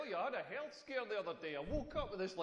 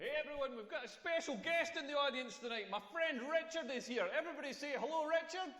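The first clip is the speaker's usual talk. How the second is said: mock host; 7 semitones higher than usual. Comic playing MC for friend.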